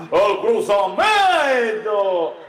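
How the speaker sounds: loud, very drawn-out mock commentary; imitated commentator's call. Retelling what the narrator said, drawn-out mock call. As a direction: hold the stressed syllable long and swoop. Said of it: "el narrador decía".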